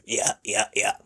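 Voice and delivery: soft, mumbled trucker replies; truck driver Gus. Retold or imitated. Imitating the truck driver guest, softly. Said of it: "truck driver Gus came in".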